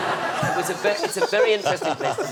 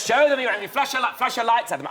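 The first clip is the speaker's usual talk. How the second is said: dad urging; 9 semitones higher than usual. Retold or imitated. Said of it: "Dad goes".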